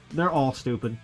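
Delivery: ordinary talk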